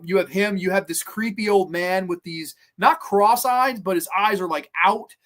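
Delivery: usual speaking voice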